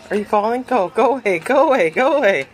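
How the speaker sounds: normal talking voice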